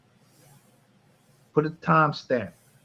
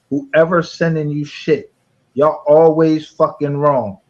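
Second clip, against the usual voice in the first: louder and slower, spitting each word; angry slow rant; 3 semitones higher, 9 dB louder, slower than usual. Real, not acted. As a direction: hammer it out in anger.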